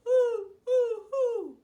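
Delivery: loud high hoots; owl hoot. Acted.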